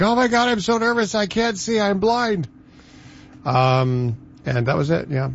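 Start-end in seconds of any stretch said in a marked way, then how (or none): 0.0-2.5 mock panicked buddy, voice pitched way up; high-pitched nervous freak-out, imitated; Corey. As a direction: play a groom's friend in a hammed-up high panic. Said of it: "my buddy Corey was like".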